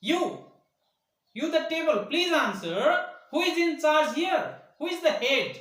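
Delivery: high, loud, insistent questioning; angry demand. A narrator's reading. Boy to the table, angry demand. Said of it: "got angry and said".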